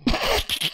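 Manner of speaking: short airy giggle; a quick breathy chuckle; breathy laugh. Real, not acted. Laughs at his own teaser.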